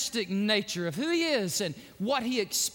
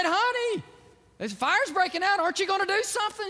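high, raised plea to a wife; acted frantic husband, pitch way up; alarmed calling out; 11 semitones higher, 4 dB louder than usual. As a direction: call for help in mock alarm. Panicked husband calling to his wife.

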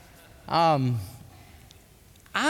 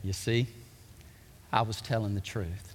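softer, lower, calm; hushed, lowered quote; quiet vindicated Jesus; 10 semitones lower, 8 dB quieter than usual. Retold or imitated. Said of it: "he comes back from the dead to say".

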